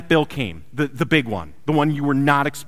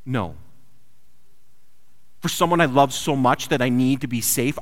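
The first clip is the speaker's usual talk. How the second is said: usual speaking voice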